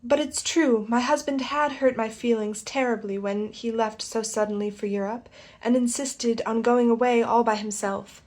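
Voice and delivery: ordinary delivery, nothing marked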